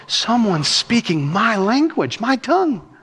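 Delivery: slow, high-pitched wonder, climbing at the end; amazed discovery. Acted. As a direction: voice a listener thrilled to hear their own tongue. Acts hearers' amazed discovery.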